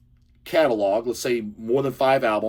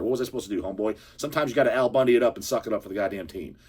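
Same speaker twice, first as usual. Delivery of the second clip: ordinary talk, nothing marked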